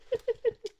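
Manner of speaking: a squeaky burst of laughter; high giggle. Real, not acted. Laughs at the teasing.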